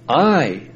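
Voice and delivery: "I" drawn out and set apart by a pause; emphatic I. Shown as a demonstration. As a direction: give "I" full weight, standing alone. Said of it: "is emphatic".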